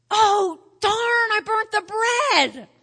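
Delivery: loud, slow wail over burnt bread; hammed-up cry of kitchen disaster; mock dismay. Martha discovers burnt bread.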